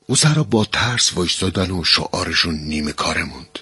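ordinary delivery, nothing marked

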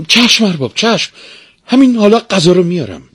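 high-pitched, eager-to-obey servant; hurried servant Gretel. A narrator's reading. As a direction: answer the master promptly in a high voice. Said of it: "گرتل به تندی گفت".

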